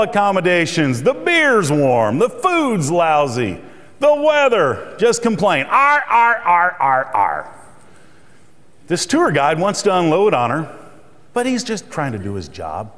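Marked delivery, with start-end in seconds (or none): 5.7-7.5 wordless complaining ar ar, pitched up then dropping; mock grumbling. Tourist complaining about everything, mock grumble.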